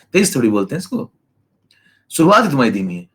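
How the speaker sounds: ordinary talk, nothing marked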